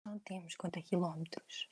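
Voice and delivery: hushed, breathy inspirational-quote delivery; inspirational whisper. Shown as a demonstration. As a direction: whisper it like a motivational slogan. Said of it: "dizíamos isto de uma forma mais tipo". (sussurro inspiracional)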